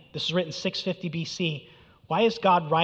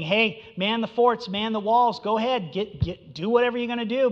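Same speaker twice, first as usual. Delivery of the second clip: loud, higher mocking dare to the city; a preacher voicing the prophet's scornful taunt; 6 semitones higher, 4 dB louder than usual. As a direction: taunt the enemy, daring them to try. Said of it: "this is a mockery here".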